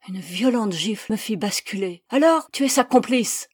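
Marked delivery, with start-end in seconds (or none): 2.1-3.5 loud, raised accusing cry; shouted accusation. A narrator's reading. Persecutor accuses the woman after a slap, shouting. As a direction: cry out the charge. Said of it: "cria mon persécuteur".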